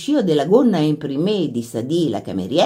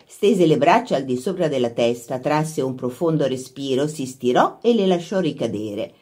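plain reading voice

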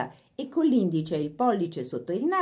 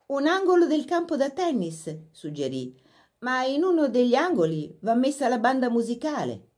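put-on lifted, eager girl's voice; a young hostess making suggestions, lightly voiced girl; young Laura's voice; 7 semitones higher than usual. A narrator's reading. Laura to the workmen, lightly voiced eager girl.

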